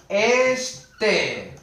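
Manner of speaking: ordinary talk, nothing marked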